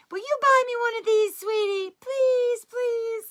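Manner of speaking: high, pleading sweet-talk to husband; wheedling wife. Retold or imitated. Wheedling wife begging for a gift.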